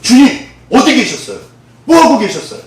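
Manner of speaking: talking back to God; defiant protest. Retold or imitated. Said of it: "제가 그러고 대들었었거든요". (하나님께 대드는 목소리)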